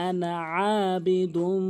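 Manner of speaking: আবিদুম drawn out slowly, rising at the end; slow recitation. Shown as a demonstration. Teacher reciting a Quran word slowly for students. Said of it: "তাসদিদ আলা হরফকে ধরতে হয়".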